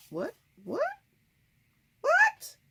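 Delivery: rising to a high, loud squeal of disbelief; shocked disbelief. Retold or imitated. Told she has a Neapolitan accent, a rising shocked squeal. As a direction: build it up to a mock shocked shriek.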